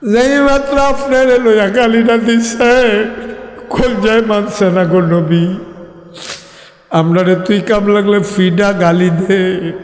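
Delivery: heartbroken lament. Acted. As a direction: wail the grief melodically to the Prophet. Heartbroken companion laments the insult.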